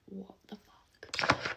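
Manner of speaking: slow, imitated whisper of disbelief; mock whisper. Mum reacting to the man beside them, mock whisper. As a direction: whisper it like a stunned mum, drawn out. Mum's shocked hushed reaction, retold.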